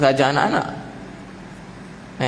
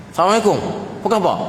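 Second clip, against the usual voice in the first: curt greeting; 7 semitones higher, much faster than usual. Shown as a demonstration. Preacher acts curt greeting.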